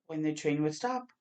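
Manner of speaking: ordinary delivery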